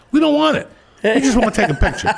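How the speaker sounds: very high mock family voice; insistent fans. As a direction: imitate clueless fans insisting, squeaky-high. Family insists on picture in story.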